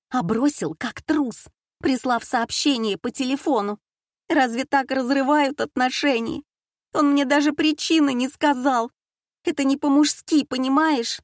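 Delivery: высоко, с обидой и слезами; жалуется сквозь слёзы; плаксиво. A narrator's reading. Плачущая дочь жалуется матери. (плаксивая жалоба)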